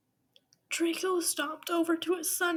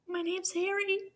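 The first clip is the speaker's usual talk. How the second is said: put-on child voice; 7 semitones higher than usual. Child Harry speaks.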